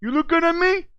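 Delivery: put-on high, loud, clumsy New York mobster accent; bad mob accent. Cohost voicing Wise Guy in a bad mob accent, loud. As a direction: throw out tough mob-guy threats in a wobbly accent. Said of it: "I'm really bad at accents".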